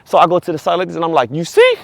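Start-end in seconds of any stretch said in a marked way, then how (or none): 1.3-1.8 sharp, high-pitched you see. Retold or imitated. Vindicated worker to manager, high incredulous you see. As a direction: squeak it out in disbelief.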